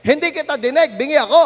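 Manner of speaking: put-on loud old man yelling; deaf man shouting. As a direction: shout it like a man who can't hear himself. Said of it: "lakas ng sigaw niya".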